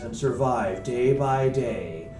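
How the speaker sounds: slow, low trailer narrator; movie trailer voice. Acted. Summing up a book as a trailer, slow low voice. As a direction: draw out each phrase with mock gravity. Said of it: "get that voice in your head".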